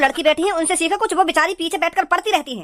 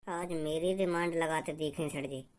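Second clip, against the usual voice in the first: mumbled reply; 12 semitones lower, 16 dB quieter, slower than usual. Acted. Student answering teacher.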